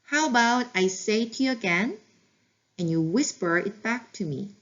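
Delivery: every word stretched, calm and gentle; slow model phrase. Shown as a demonstration. Teacher modeling a gentle request to a child, very slow. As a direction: model it slowly and kindly for the parent. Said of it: "이렇게 말씀해 주세요".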